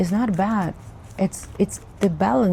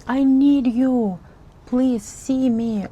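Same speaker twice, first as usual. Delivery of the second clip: put-on high, drawn-out childlike plea; begging inner child; 5 semitones higher, slower than usual. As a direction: plead slowly like a little kid left behind. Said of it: "who is just begging".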